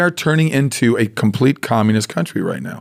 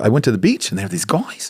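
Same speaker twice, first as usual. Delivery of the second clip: "I went to the beach and there were these guys" with the pitch rising up on "beach" and every phrase end, like a question; imitating a New Zealand woman, every phrase lifting at the end; mock Kiwi upswing, each phrase ending on a rise; upswing intonation; 4 semitones higher than usual. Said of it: "They talk on the upswing".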